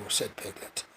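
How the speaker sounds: ordinary delivery, nothing marked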